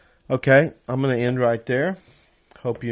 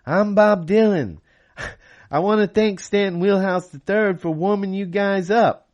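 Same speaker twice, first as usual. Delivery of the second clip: [name] impression; 10 semitones higher, 3 dB louder than usual. Singer at the mic doing [name] for the diners. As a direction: do your best [name] for the crowd. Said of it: "said in my best [name] voice".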